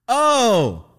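loud, high, drawn-out mock "oh"; pissed-off realisation. Student hears it was just a scare, pissed off. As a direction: let the outrage burst into one vowel. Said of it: "that pissed me off even more".

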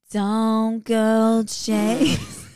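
slow, stretched, raspy imitation. Mimicking the singer's raspy voice for a friend, lightly. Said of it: "she's like".

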